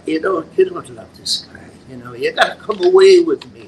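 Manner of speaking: a hint of Irish, urging her to come away; Irish accent. Juvenile lead urging the married girl in an Irish accent. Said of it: "I did it with an Irish accent".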